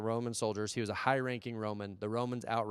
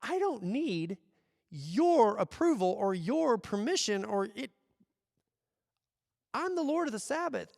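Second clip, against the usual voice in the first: bold declaration; 9 semitones higher, slower than usual. Retold or imitated. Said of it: "Jesus is going like".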